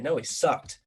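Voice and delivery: ordinary talk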